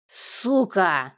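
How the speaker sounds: loud, explosive insult; angry outcry; furious shout. A narrator's reading. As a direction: hurl the curse out loudly. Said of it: "выкрикнул Славик".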